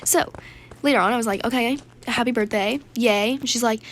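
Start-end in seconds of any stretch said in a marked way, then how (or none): 1.4-3.3 understated cheer in an everyday pitch; half-hearted congratulations. Retold or imitated. Player reluctantly playing along with birthday again, half-hearted. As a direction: go through the motions of congratulating. Later, wearier repeat of congratulations.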